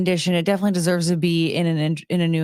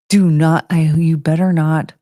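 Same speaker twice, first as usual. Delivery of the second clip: louder scolding, warning the dog off; stern warning to the dog; 3 semitones lower, 7 dB louder than usual. Real, not acted. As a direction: call the dog off firmly. Dog about to pee inside.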